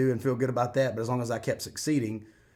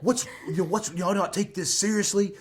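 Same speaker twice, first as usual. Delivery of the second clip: higher, louder imitation of a demanding trainer; mock scolding; 8 semitones higher, 6 dB louder than usual. Trainer pushing contestant to get angry, mock stern. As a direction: press the order home, playfully retold.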